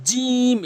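"জিম" with a long held vowel; drawn-out letter. Shown as a demonstration. Teacher showing learners how to stretch "জিম". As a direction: stretch "জিম" firmly from mid-tongue. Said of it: "এভাবে টেনে উচ্চারণ করবেন".

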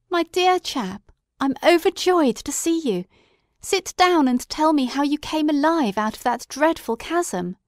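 glowing with joy at the reunion; overjoyed Watson. A narrator's reading. Watson welcoming Holmes back, high and overjoyed.

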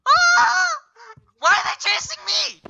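high, loud terrified shriek; screaming while being chased; panicked scream. Real, not acted.

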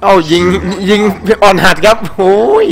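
high, loud gamer taunt; excited taunting shout. Real, not acted. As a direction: call it out loudly mid-fight, voice jumping up. Excited taunt during game fight. (ตะโกนเยาะเย้ยเสียงสูงอย่างตื่นเต้น)

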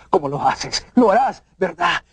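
ordinary talk, nothing marked

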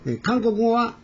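ordinary talk

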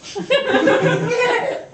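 high villain cackle; mock evil cackle. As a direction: cackle triumphantly. Villain laugh.

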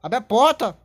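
loud urgent command; urgent shout. Real, not acted. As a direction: yell it in a panic. Shouting during chase.

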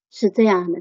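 ordinary delivery

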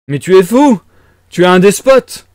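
loud, high outraged shout; yelling. A narrator's reading. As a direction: bellow the accusation at full tilt. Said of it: "hurla Razumikin".

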